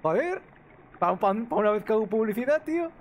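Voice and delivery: loud, high exasperated complaint; exasperated outburst. Real, not acted. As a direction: blurt it out in protest. Caught advertising on stream, exasperated.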